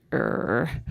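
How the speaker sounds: a low growl of annoyance; irritated growl at a tissue; frustrated low growl. Real, not acted. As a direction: grumble low in the throat.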